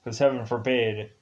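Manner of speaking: ordinary talk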